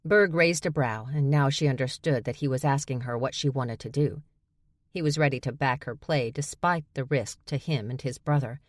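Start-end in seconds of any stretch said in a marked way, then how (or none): none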